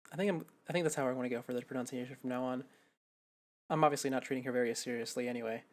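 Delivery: normal talking voice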